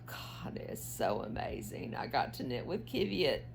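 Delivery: breathless hushed awe; awed whisper. Real, not acted. Knitter gushing to viewers about yarn, in an awed whisper. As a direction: whisper it like a treasure.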